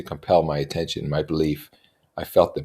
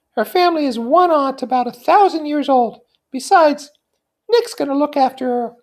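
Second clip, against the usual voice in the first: put-on high, light young society woman; Daisy's voice; 7 dB louder than usual. Daisy confiding in her cousin Nick, high and light. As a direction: lift into a bright, high feminine voice.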